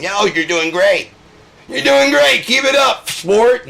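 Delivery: shouted sarcastic cheerleading, very high; loud, high mocking pep talk; mock encouragement. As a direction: yell fake praise at the top of your voice. Mock encouragement inside the tirade.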